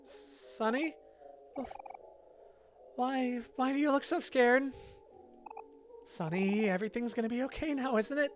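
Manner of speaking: high, pleading concern; worried character voice. Acted. Asking frightened Sonny what is wrong, worried and high.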